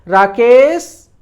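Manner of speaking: loud drawn-out name call, rising; calling from afar. Shown as a demonstration. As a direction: call out Rakesh to someone far away. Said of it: "कैसे चिल्लाएंगे?".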